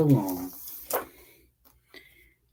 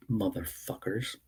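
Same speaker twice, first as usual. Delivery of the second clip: usual speaking voice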